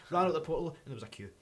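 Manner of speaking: usual speaking voice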